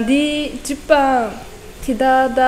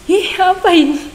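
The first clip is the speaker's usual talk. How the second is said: surprised; 6 semitones higher, 5 dB louder than usual. Retold or imitated. Retelling her first reaction.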